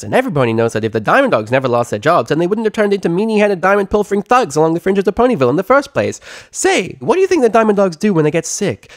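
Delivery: very high, fast, bouncy chatterbox; high giddy pony gabbing; bubbly pony chatter. Acted. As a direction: gush it out without breathing. Pinkie Pie voice.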